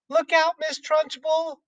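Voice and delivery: put-on high child's voice warning the headmistress; Lavender crying out. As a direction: call the warning like a small girl. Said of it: "cried Lavender".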